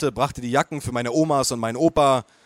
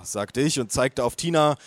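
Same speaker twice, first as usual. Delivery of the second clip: plain reading voice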